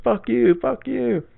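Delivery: high-pitched mock cursing, repeated; ranting crackhead. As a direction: imitate a man cursing in someone's face. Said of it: "he talked like a fucking crackhead".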